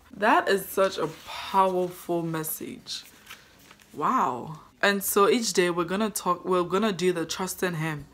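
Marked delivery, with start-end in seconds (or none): none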